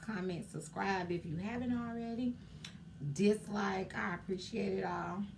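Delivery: usual speaking voice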